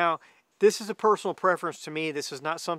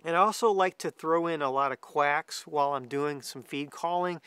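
ordinary delivery

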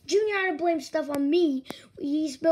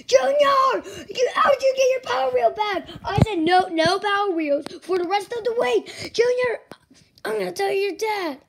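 loud, higher angry yell; angry shout; 4 semitones higher, 5 dB louder than usual. Acted. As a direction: scold the kid at full voice. Chef yelling at Junior.

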